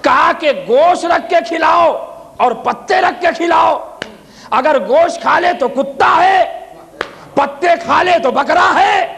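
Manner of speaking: preaching at full force. Acted. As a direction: ring out each answer to the crowd. Ali's answers preached loud.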